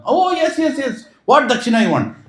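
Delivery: very high, eager yeses; eager offer. Acted. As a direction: gladly ask what they want.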